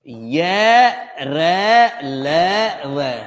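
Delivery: "ये रे ले वे" spelled very slowly; slow recitation. Shown as a demonstration. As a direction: chant "ये रे ले वे" slowly. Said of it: "teacher recites letters". (धीमे स्वर में "ये रे ले वे")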